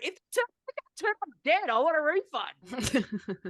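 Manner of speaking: high-pitched, laughing-mad comeback, re-enacted; incredulous retort. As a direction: squeal the comeback in disbelief, then stretch the demand. Retells her retort to the company.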